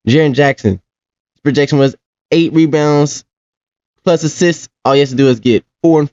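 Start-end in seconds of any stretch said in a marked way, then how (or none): none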